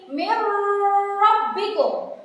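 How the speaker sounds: Mir rabbikum with the r hummed through the nose; slow Mir rabbikum with a nasal hum before rabbikum; wrong nasal read. Shown as a demonstration. Teacher plays the wrong humming reading for pupils. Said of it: "Ada dengungannya di situ".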